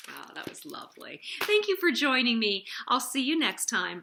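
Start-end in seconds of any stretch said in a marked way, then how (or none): 0.1-1.2 soft, tender and pleased; warm. Real, not acted. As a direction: gently sigh out how lovely it was. Host ends story softly.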